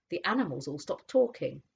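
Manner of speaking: usual speaking voice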